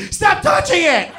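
very high, sudden yelled panic; a shrieking "Stop touching it!"; panicked shout. Retold or imitated. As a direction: yell it in sheer alarm. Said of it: "I'm like".

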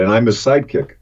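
usual speaking voice

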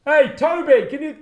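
high, loud shout over a machine; shouting over noise. Retold or imitated. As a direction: yell over the digger noise. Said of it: "Couldn't hear. Too loud".

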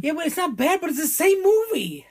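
high-pitched, exasperated objection; exasperated protest. Real, not acted. Disputing a friend's praise of the remake, exasperated protest.

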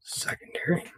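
soft unvoiced whisper of the word Secondary. Real, not acted. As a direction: breathe Secondary to yourself, barely voiced. Repeating the color name.

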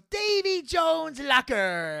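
high, loud, long-drawn ghostly proclamation; Flying Dutchman. Retold or imitated. Ghost pirate naming the destination, loud drawn-out proclamation. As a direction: boom it out slowly, stretching each word. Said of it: "the Flying Dutchman comes in".

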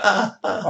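retold ah of pain, pitched high; two high pained ahs; mock pain groan. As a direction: groan as if hobbling over a fence. Climbing fence on broken foot.

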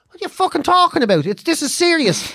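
high, incredulous protest; mock exasperation. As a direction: argue back at a stubborn mum. Exasperated child answering mother.

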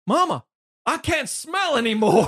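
put-on high, loud, slow childish whine; mock child whining to his mama; whiny child voice. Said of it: "we're gonna do that".

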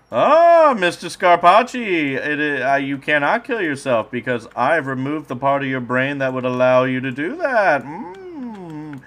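high, loud mad-scientist villain. Acted. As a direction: gloat like a cartoon villain. Villain addressing captive.

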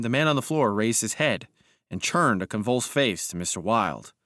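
plain reading voice

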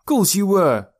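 high, loud comeback; high-pitched boyish taunt; teasing retort. A narrator's reading. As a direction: crow it back at him. Boys bickering, teasing retort.

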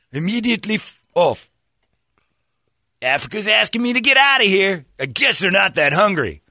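high, indignant caricature; mock villager voice. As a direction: send him off with lofty disdain. Voicing villagers rejecting Fieri.

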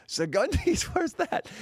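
high confused voice; puzzled taxi guy. Retold or imitated. Taxi guy asks where the place is, high and confused. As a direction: sound baffled, pitch up. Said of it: "he's like".

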